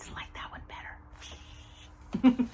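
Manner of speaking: breathy whisper, confiding; hushed playful confession; whispered aside. Real, not acted. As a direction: lean in and whisper the secret. Confiding aside about old paper.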